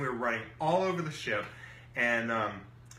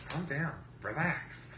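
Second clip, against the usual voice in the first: softer, slow calming voice; imitated soothing; 3 semitones higher, 5 dB quieter than usual. Partner calming the angry storyteller, soft slow soothing imitation. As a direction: talk them down slowly and softly.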